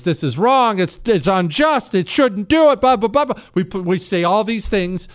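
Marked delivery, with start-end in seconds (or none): 0.0-3.4 mock outrage. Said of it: "outrage you or I have expressed".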